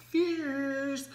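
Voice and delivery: very high, slow, drawn-out fierce; high sing-song diva praise; diva drawl. Pretend. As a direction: stretch it like a campy diva.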